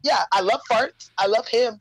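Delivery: normal talking voice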